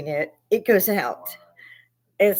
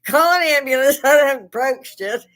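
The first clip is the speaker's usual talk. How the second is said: loud laughing yell, higher; retold yell to the house, half laughing; yelling for help; 6 semitones higher, 7 dB louder than usual. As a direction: shout it out while falling, half laughing. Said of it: "I'm yelling before I land".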